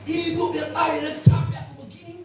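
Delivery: mock shout. Shown as a demonstration. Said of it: "marahnya nggak bentak-bentak".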